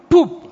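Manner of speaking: sharp high pup, shouted out; smack sound. Retold or imitated. Mom's slap on the child, a loud high pup. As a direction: pop it out like a slap landing.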